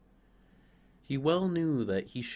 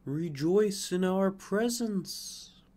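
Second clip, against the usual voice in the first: slow, higher, louder, glowing call; air and sunlight; 8 semitones higher, 4 dB louder, slower than usual. Acted. Air and sunlight urging the fir to rejoice. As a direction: sing it out like warm light. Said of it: "said the air and the sunlight".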